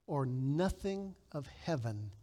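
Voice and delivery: slow, hushed, weighty reading; solemn quotation. Acted. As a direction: let every phrase sink in slowly. Reads Murray quote solemnly.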